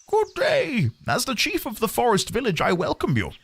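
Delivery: lightly voiced high old chief, greeting; old village chief. Village chief greets the newcomer, high-pitched elder voice. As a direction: play the chief welcoming a stranger.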